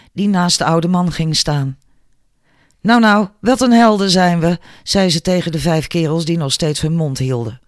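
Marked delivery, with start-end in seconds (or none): 2.8-4.6 raised, sneering nou, nou; mocking sarcasm. A narrator's reading. Mary sneering at the five bullies, loud sarcasm. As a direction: scorn them as fake heroes.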